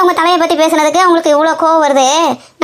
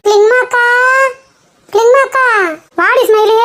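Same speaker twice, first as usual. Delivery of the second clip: calling out; 7 semitones higher, slower than usual. Acted. Neighbour calling from outside.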